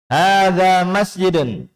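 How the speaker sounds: "Hadza masjidun" high and slow, long vowels held, falling at the end; model statement intonation. Shown as a demonstration. Teacher reads for the class to follow, drawn-out statement intonation. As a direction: draw out "Hadza masjidun" on a high pitch, then let it fall. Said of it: "Perhatikan panjang pendeknya dan intonasinya".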